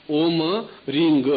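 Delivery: ओम ending on a humming म, रिंग ending on a clear ग; clear mantra syllables. Shown as a demonstration. Guru demonstrating the mantra sounds to disciples, careful pronunciation. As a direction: sound out ओम with a closing m and रिंग with a final g. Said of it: "ओम में म की ध्वनि आएगी, यहाँ ग की ध्वनि आएगी".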